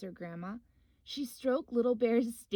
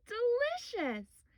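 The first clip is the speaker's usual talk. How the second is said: high squealing giggle, then a slow, higher Delicious; laughing cub; 13 semitones higher, slower than usual. Acted. Little Bear finding ants, high delighted laugh. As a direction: giggle with joy over the ants. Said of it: "he laughed".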